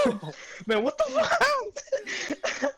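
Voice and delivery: high, slow panicked wail with a laugh; mock cry for help. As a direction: wail it like a hurt kid, laughing. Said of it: "I was like".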